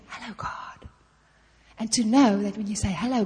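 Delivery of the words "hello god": barely voiced, shy little greeting; timid whisper. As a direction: whisper it as if you dare not speak to God. Too timid to greet God.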